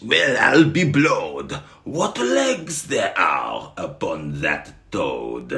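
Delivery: loud, high, rowdy Frenchmen yelling; hammed-up shouting crowd, slowing on the second line. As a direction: bellow it like a greedy mob spotting dinner. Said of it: "They screamed in French".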